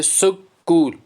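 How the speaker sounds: "सुकूल" split as सु, कूल; syllable by syllable. Shown as a demonstration. Teacher showing where the dictionary dot goes, syllable by syllable. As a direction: say "सुकूल" slowly in two separate beats. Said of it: "इसमें दो syllable हैं".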